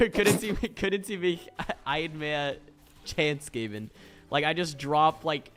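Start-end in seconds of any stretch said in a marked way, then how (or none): 0.1-3.9 laughing through German. Retold or imitated. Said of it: "I'll be like".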